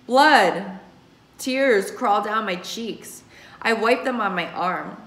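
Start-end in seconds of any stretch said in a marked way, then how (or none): none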